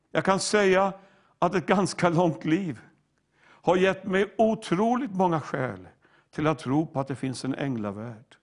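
normal talking voice

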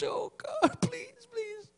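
very high, teary mock sob on "please"; hammed-up weepy prayer; fake crying plea. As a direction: imitate forced tears while begging. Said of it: "squirt them some tears".

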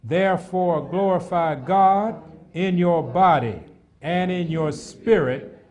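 loud, slow, measured recitation of scripture; scripture read together. Real, not acted. Congregation reading the verse aloud together, slow and loud. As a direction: read it out slow and full like the whole church is with you. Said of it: "Let's read the rest together".

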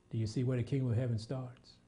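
normal talking voice

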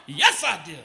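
mock-fervent reply; with fervor. Shown as a demonstration. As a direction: punch it out with fervor. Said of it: "said that with more fervor".

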